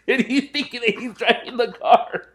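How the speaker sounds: high squeaky laugh through the line; can't stop laughing telling it. Real, not acted. Laughing while recounting.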